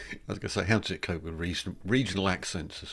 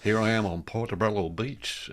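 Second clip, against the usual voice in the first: imitated Scottish TV reporter, higher pitched; Scottish accent reporter; 4 semitones higher, 4 dB louder than usual. Imitating AI reporter on a live beach broadcast, Scottish accent. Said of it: "with a very good Scottish accent".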